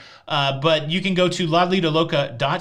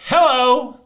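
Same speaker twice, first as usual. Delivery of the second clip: loud, high American hello; exaggerated American greeting; 8 semitones higher, 7 dB louder than usual. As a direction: holler it like a brash American tourist. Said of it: "people will know that I'm American".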